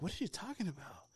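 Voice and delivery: high, hushed disbelief; retold baffled whisper. Student puzzled by teacher's praise, whispering in disbelief.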